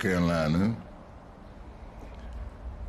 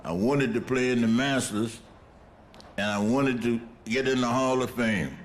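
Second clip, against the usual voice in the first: ordinary talk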